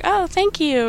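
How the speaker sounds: high, coy little thank-you; flirty thanks. Retold or imitated. Amanda answers Adam's compliment, high coy flirting. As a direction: play a cute, flattered reply. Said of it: "a whole little flirting moment".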